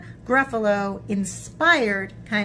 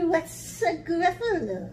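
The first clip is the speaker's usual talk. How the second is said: puzzled snake; 8 semitones higher than usual. Acted.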